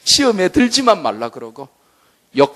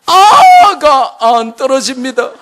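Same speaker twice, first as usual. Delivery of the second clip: loud, higher crying shout, slow; crying wife; 10 semitones higher, 8 dB louder, slower than usual. Retold or imitated. Mother crying to husband baby won't drop, crying shout. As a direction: wail to the husband in despair. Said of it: "큰일 났잖아요".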